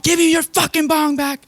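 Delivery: hammed-up hysterical scream about the bong; screaming girlfriend. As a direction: shriek it like she's screaming down the call. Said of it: "She's immediately screaming to me about her bong".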